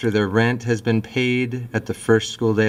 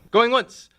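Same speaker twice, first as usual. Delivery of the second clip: auctioneer voice; much slower than usual. Retold or imitated. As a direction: call out the final bid. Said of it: "so I say, you know".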